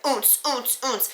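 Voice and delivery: soft, high rhythmic thumps; imitated club bass. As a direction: mimic a thumping bass beat softly. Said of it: "the bass is like".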